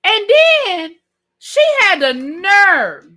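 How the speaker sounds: loud, drawn-out disbelief; indignant outburst. Real, not acted. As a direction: stretch it out loudly, scandalized. Indignant build-up to next complaint.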